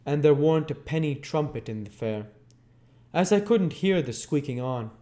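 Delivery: voicing a rough Londoner recalling jail; working-class man's voice. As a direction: voice the old lag telling his tale. Character voice in Oliver Twist reading.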